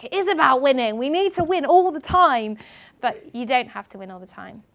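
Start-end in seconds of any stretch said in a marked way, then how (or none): 0.0-2.6 put-on loud, higher insistent kid voice; stubborn young athlete. Remembering school, loud higher insistent child voice. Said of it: "'cause I was like".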